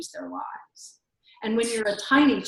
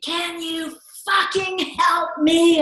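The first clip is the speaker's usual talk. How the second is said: higher, slow, retold angry yell; woman at the zoo screaming back; mock screaming; 9 semitones higher, slower than usual. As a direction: imitate a furious stranger yelling back. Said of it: "started screaming back at me".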